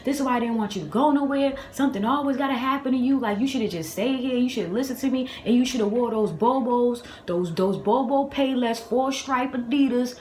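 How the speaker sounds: mimicking mom's running told-you-so scold; imitated scolding mother. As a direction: lecture the kid in mock exasperation.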